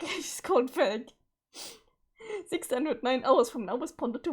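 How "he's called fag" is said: laughing. Real, not acted. Laughing at an acronym name.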